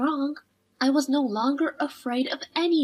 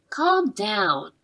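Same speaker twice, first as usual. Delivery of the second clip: ordinary delivery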